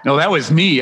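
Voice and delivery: ordinary talk, nothing marked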